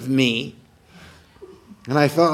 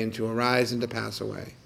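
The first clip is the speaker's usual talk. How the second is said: softer fading echo; 2 semitones lower, 7 dB quieter than usual. Acted. Repeated phrase, echoed softly.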